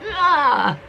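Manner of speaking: strained mock wail of frustration; frustrated cry. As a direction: let out an exasperated ah. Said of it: "they're just like".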